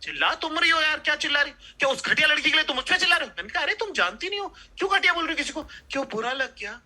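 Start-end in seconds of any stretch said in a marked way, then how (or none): 0.0-3.3 higher defensive comeback; hammed-up quarrel retort; mock indignant. Retold quarrel reply. 3.6-6.8 hammed-up jealous taunt; mock taunt. Girlfriend voiced taunting.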